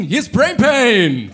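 slow, loud show opener shout. Real, not acted. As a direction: call it out to the arena.

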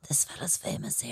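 whispered aside so the man can't hear. Retold or imitated. Whispering to her friend about the famous man beside them. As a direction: breathe it to your friend, all air.

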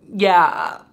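high, loud, wobbly mock-tearful yeah. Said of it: "and she's like".

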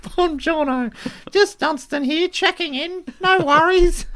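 very high squeaky sign-in; a chirpy cartoon checking in; Dunstan's shrill voice. Dunstan checks in with his catchphrase, very high and squeaky. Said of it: "that's what he'd often say".

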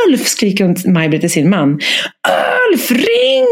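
yelling for her husband to phone; retold scream. Woman screaming to her husband to call for help. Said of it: "hör jag hur hon skriker".

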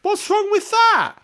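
high, loud, slow mock indignation; mock outrage. Real, not acted. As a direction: play it hammed-up offended.

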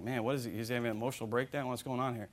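low, soft murmur among brothers; hushed onlookers' commentary; puzzled aside. Acted. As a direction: confer under your breath, baffled. Voicing puzzled brothers' whispered aside.

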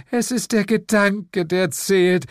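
high-pitched sweet reassurance; consoling Lilith. A narrator's reading. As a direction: soothe him in a high, doting voice. Lilith consoles her devil sweetly. (hoch und liebevoll tröstend)

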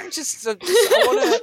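goblin voice. Pretend. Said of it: "in a goblin voice".

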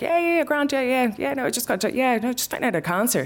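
very high, stumbling over-breezy babble; high-pitched babbling. Retold or imitated. Patient blurting news to dentist, high-pitched babbling. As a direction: brush it off in a high, repetitive ramble.

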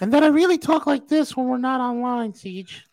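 squeaky high put-on voice; high-pitched voice. Shown as a demonstration. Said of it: "my voice was, uh, kind of high".

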